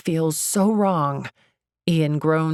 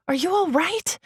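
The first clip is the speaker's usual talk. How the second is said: concerned; 14 semitones higher than usual. A narrator's reading. Concerned to injured Ian.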